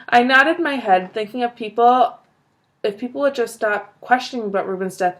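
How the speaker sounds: plain reading voice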